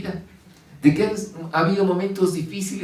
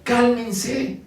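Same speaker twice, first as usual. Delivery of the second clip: higher, commanding call to the storm; raised, firm command to the waves; stern rebuke; 6 semitones higher, much slower than usual. A narrator's reading. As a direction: order the wind to be still. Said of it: "reprendió al viento y dijo a las olas".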